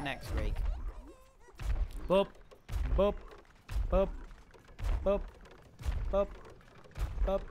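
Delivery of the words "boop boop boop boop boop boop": clipped little boop sound effects; playful boop noises; short boop noises. Pretend. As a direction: pop each boop out.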